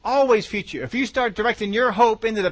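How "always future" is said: usual speaking voice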